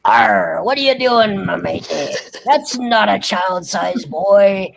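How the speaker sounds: put-on low, pirate-style old salt voice; crusty old cuss talking pirate; crusty old sailor. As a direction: growl it out like a crusty seadog. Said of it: "He speaks like".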